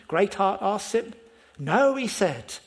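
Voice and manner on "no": a firm, louder no; fearless no. A narrator's reading. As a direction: answer without a flicker of fear. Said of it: "He's fearless".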